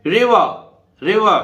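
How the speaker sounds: रिवर as "रिवा", the first r kept and the last dropped; silent final r. Shown as a demonstration. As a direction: say रिवर ending on "आ". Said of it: "last का जो आर है इसको नहीं बोलेंगे". (रिवर को "रिवा" बोलें)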